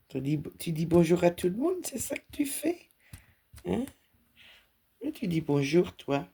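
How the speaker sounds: high-pitched cooing at the cat; high sing-song voice for a pet; pet talk. Real, not acted. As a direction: coo at your cat as she paws at you.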